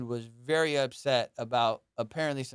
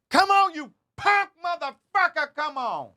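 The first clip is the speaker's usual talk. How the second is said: much higher, much louder furious yell; full-volume mock street shout; street guy shouting; 7 dB louder than usual. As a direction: scream it like a street fight. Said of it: "Like that".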